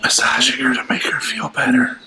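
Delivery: hushed, breathy whisper. Real, not acted. Whispering beside the dog.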